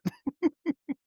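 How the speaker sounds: high burst of laughter; a real laugh. Real, not acted. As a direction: laugh at your own joke. Laughs after joke.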